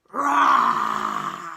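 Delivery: huge low roaring howl; dinosaur roar. A narrator's reading. Angry T-Rex roaring at the jungle, full roar. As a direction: let out a monster roar.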